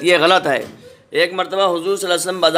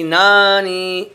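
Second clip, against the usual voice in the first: slow blended word; 3 semitones higher, much slower than usual. Shown as a demonstration. Teacher showing pupils the joined word दादी, slow and clear. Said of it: "नून ये ना".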